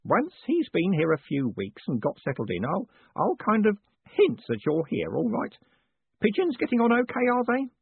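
high-pitched, ingratiating character voice; high, hesitant small talk; placating Grote. Acted.

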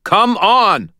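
high, loud shout; urgent shout. A narrator's reading. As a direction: yell to hurry him up. Martin urging him up stairs.

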